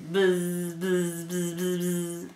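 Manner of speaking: low, sustained buzzing z's; buzzing fly. Acted. Parent showing what flies say, low steady buzz. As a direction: drone like a fly circling. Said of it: "And the flies say".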